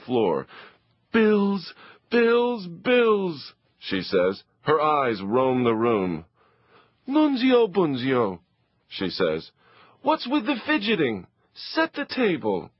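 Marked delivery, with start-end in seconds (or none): 1.1-3.5 exasperated mother. Acted. Mother flinging bills on the floor, high and fed up. 7.1-8.4 fussing mother. Acted. Mother voiced. 10.0-12.7 scolding mother. Acted.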